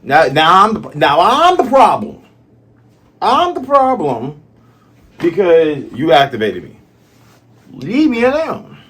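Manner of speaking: fed up and mock-offended, pitch climbing; imitating getting activated, high and loud; mock indignant outburst.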